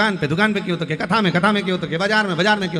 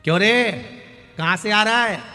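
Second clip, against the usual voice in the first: brusque call; 3 semitones higher, 4 dB louder than usual. Acted. Old mother questions beggar.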